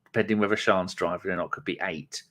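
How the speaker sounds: ordinary delivery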